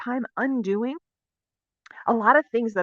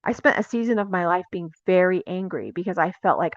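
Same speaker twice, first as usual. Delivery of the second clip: ordinary talk, nothing marked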